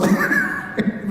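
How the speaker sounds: very high, breathy burst of laughter. Real, not acted. Preacher cracking up after mimicking the cinema announcement. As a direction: crack up at the joke. Laughs at his own imitation.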